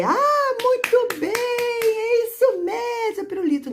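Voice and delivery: mock the too-easy "well done"; high sing-song fake praise to a child; overpraising teacher. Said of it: "Você não vai dizer".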